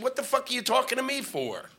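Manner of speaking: comic on the phone to USA Today, exasperated rant; retold angry rant. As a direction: tell off the newspaper caller. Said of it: "I said".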